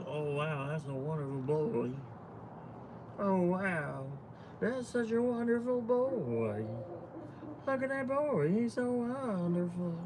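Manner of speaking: soft, high cooing that climbs and stretches out; imitated gushing followers. Streamer imitating followers admiring her dog, gushing coo. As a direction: mimic adoring fans cooing over a dog. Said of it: "They're saying it in that voice".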